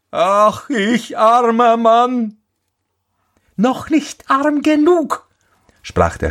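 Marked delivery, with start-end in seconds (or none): 0.1-2.4 high, drawn-out wail; lamenting cry. A narrator's reading. Carter laments his dead horse. 3.5-5.3 very high, slow bird voice; taunting sparrow. A narrator's reading.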